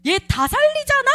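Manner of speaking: high, loud shout; screaming again. Retold or imitated. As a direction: cry it out as a possessed voice. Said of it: "제가 막 소리를 지르는 거죠".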